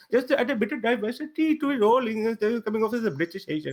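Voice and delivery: Indian accent. Shown as a demonstration. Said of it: "put on an Indian accent and talk like this".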